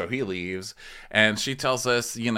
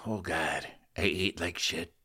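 softer, lower mock hungover moan; hungover groan; 3 semitones lower, 9 dB quieter than usual. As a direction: moan it like a guy full of ice cream. Luke hungover in the morning.

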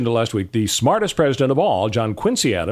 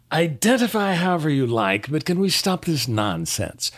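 put-on old-fashioned parliamentarian voice, slowing at the end; James Mason; 3 semitones higher than usual. Member of parliament standing up to object, James Mason impression. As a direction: impersonate a fuddy-duddy MP, drawing out the objection. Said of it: "really good James Mason impression".

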